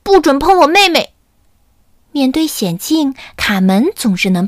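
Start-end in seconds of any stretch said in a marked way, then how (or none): 0.0-1.1 high, loud brave threat; defiant warning. Acted. Camelido defends his sister, loud and defiant. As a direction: stand up to the dog. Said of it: "卡梅利多准备和恶狗决斗".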